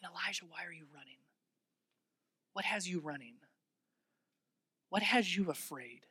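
hushed near-whisper questioning; God's gentle whisper to a frightened man; quiet, gentle voice. Acted. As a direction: ask softly, leaving room after each question. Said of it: "It was in this quiet moment".

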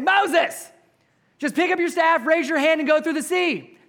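breezy divine command, big and high; nonchalant God. Retold or imitated. God telling Moses to split the sea, casually and loudly. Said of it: "the nonchalantness of God saying".

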